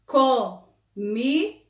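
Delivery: put-on "Comida" drawn out slowly for children; slow, clear read-aloud of the word. Shown as a demonstration. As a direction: stretch the word slowly so kids can follow. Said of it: "Olha só".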